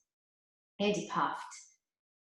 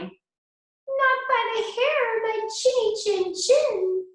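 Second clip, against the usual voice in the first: high, slow little pig voice; a tiny pig squealing his refusal, slow; little pig crying out; 14 semitones higher, 4 dB louder than usual. A narrator's reading. First little pig refusing the wolf, high and slow. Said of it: "The first little pig cried".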